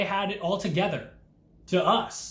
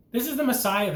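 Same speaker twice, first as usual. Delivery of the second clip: a higher, sneering take on the mocking thief; 6 semitones higher, faster than usual. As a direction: toss the words out as a taunt. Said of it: "one of them said".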